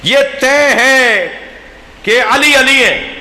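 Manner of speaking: high, slow, emphatic pulpit declaration; impassioned preaching. Real, not acted. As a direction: proclaim it forcefully, stretching the words. Preaches at full force.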